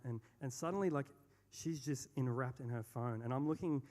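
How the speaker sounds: usual speaking voice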